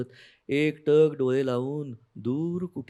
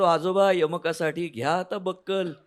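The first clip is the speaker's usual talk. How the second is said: higher, mocking tease; teasing grandpa; 6 semitones higher, 5 dB louder than usual. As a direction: needle him with a rhyme. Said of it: "मी खिजून म्हणायचो".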